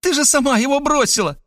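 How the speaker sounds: put-on high girlish taunt. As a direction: throw it in her face. Girl taunts rival. (высокий дразнящий девичий голос)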